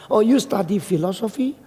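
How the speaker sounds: high, admiring flattery; using psychology. Retold or imitated. Preacher flatters student to distract her, high admiring tone. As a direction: praise with exaggerated high-pitched interest. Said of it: "saya pakai psikologi".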